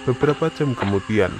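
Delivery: plain reading voice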